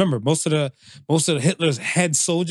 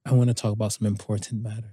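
low, quieter, quick announcement; lowered-jaw serious tone; 8 semitones lower, 6 dB quieter, faster than usual. Real, not acted. Podcast host introducing important matters, low mock-serious tone. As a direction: drop the jaw and go deep. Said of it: "Anytime you say something in that tone, in the lower of the jaw".

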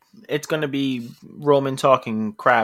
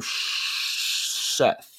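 drawn-out pick; 8 dB quieter than usual. Real, not acted.

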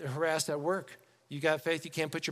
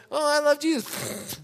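high mocking mimicry; making fun; 8 semitones higher than usual. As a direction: parrot it back to ridicule the believer. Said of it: "You get made fun of".

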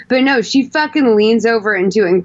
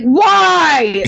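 a higher, barked "Why?"; abrasive mock shout; 7 semitones higher, 3 dB louder than usual. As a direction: snap it back like a rude boyfriend. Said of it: "he's, like, so ab-, abrasive".

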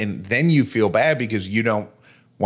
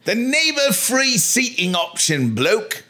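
high, drawn-out fake Australian accent; mock Australian; 12 semitones higher, slower than usual. Co-host echoing the airline program's name, hammed-up mock Australian. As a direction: slowly parrot it in hammed-up, high-pitched Australian. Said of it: "You don't sound Australian".

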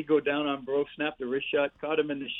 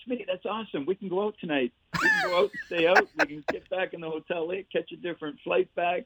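excited buddy; 6 semitones higher than usual. Retold or imitated. Teammate Davey excited about night out.

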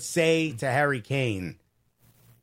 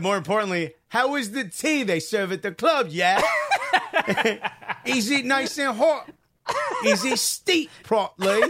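high, laughing mock English accent; 6 semitones higher than usual. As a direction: read it like a fussy English tea lover. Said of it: "forgot she's English".

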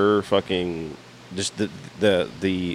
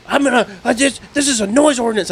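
mock neighbor voice, much higher and louder; complaining neighbor; 13 semitones higher, 4 dB louder than usual. Imitating the neighbor threatening him over the noise, high mock voice. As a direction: squeak it like an outraged busybody.

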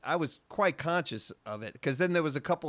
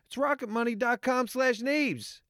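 higher repeat; 9 semitones higher than usual. Shown as a demonstration. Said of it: "What is that again?".

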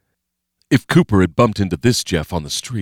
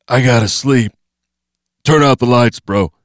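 Jeff, exhausted; 5 semitones higher, 7 dB louder than usual. Acted. Exhausted Jeff demanding lights out.

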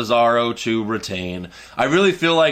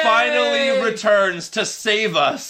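high, slow, drawn-out hype; triumphant announcement; 7 semitones higher, slower than usual. Pretend. Announcing the wrestler who saves the horrible ending, high triumphant hype. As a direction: proclaim the hero's return, stretching the words.

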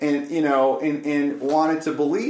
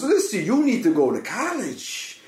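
higher, emphatic imitation of persuasion; raised pitch, pressing the point; charismatic; 4 semitones higher than usual. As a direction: imitate an old man urging with charisma. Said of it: "getting really charismatic with me".

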